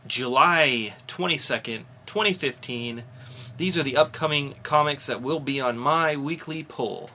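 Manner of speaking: bright comic-show announcer listing new releases; announcer read. Acted. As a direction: sell each title like a podcast host. Hosts upcoming comics rundown.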